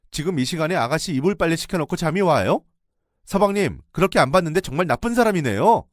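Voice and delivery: high, loud reproach; house-shaking shout. Retold or imitated. Narrator yells at the husband who slept. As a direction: rip into the husband. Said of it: "집이 떠내려가는 목소리로".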